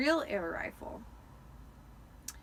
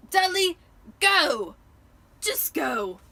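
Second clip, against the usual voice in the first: shouting; 7 semitones higher, 10 dB louder, slower than usual. A narrator's reading. Vernon shouting after the fight.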